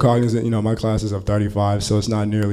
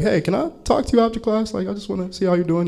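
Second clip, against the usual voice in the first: higher-pitched, tactful check-in; casual, careful request in a raised pitch; tactful approach; 9 semitones higher than usual. Retold or imitated. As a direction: approach a student tactfully, voice lifted high. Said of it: "I'm tactful".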